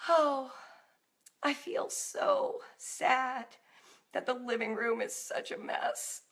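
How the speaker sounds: slow, high, sighing mock sorrow; gauzy, romanticized sadness. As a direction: swoon over the sadness, hammed-up and drawn out. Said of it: "gauzy, romanticized kind that's like".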